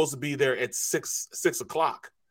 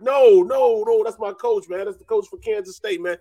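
high, loud no no no; urgent loud protest; 8 semitones higher, 6 dB louder than usual. Retold or imitated. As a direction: stop them, loud and high. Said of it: "I'm like".

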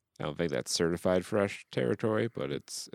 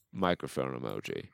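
normal talking voice

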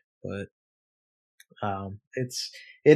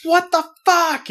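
much higher, louder shocked cry; outraged what the fuck; 6 dB louder than usual. Retold or imitated. Seeing strange purchases, high loud shocked outburst. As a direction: yelp it out in disbelief. Retelling seeing New York charges.

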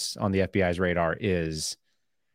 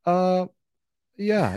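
awkward rookie; 9 semitones higher than usual. Retold or imitated. Rookie agent caught out.

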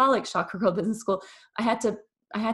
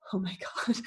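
hushed, voiceless "oh my God"; awestruck whisper; 7 dB quieter than usual. Retold or imitated. Recalling the coach's suggestion, stunned whisper. Retold stunned reaction after gasping.

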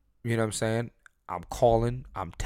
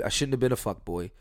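ordinary delivery

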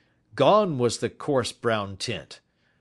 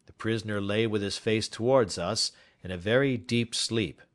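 usual speaking voice

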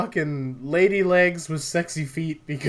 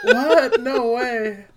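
high, loud laughing disbelief; shocked laughing protest; surprised laugh; 11 semitones higher, 7 dB louder, much slower than usual. Real, not acted. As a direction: gasp it in disbelief.

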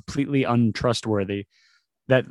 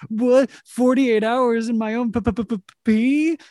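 much higher, stammering mock outrage; incredulous cry, stuttering before the word pee; mock disbelief; 11 semitones higher than usual. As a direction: sputter it like you can't believe the offer. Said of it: "But it was like".

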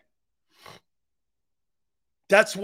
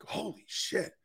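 whispered astonishment, barely voiced; awed whisper; 10 dB quieter, slower than usual. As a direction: breathe it out in a hushed whisper. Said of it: "Wife's like".

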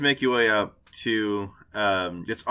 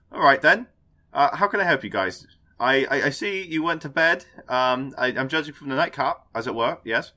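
put-on a light Cockney accent, a bit higher at the start; Cockney Taika Waititi; 3 semitones higher than usual. Game master voices a Cockney character greeting the party, light Cockney accent. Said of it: "He speaks like a Cockney Taika Waititi".

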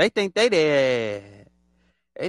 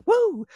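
much higher woo; mock excited woo. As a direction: cheer like getting a package. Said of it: "They just like".

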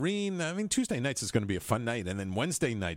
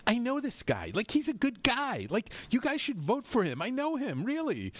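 quoted voice; 11 semitones higher than usual. Pretend. Quoting what she'd tell voters.

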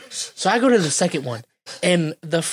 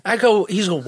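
ordinary delivery

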